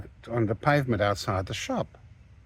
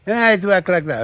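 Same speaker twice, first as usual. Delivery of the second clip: louder, higher mock angry snap; angry retort; 8 semitones higher, 7 dB louder than usual. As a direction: throw it back at him, indignant. Shop owner argues back angrily.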